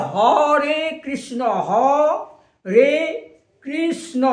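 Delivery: হরে Krishna drawn out slowly, then broken into separate syllables. Shown as a demonstration. Preacher counting the four letters of হরে Krishna, syllable by syllable. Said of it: "চারটি অক্ষর".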